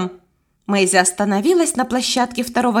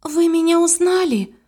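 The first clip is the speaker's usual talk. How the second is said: mildly dismayed, higher voice; high, held tone of disappointment; sad; 7 semitones higher than usual. A narrator's reading. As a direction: ask with a touch of sadness. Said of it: "грустно сказала Мэйзи".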